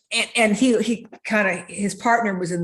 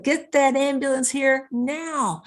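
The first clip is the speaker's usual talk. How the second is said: imitating a panicked chief, higher and slow; urgent retold command, pitched up and drawn out; panicked order; 7 semitones higher, slower than usual. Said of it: "Chief Hoffer's voice get more and more panicked".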